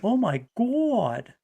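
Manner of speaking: drawn-out, high Boston "God"; Boston accent. Retold or imitated. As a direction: mimic an exasperated Boston caller. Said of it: "that's how they talk".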